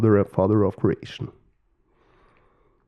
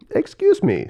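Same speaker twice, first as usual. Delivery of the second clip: high-pitched polite butting-in; interrupting peacock. Acted. Peacock interrupting the creators, high-pitched and polite. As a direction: cut in with a high bird voice.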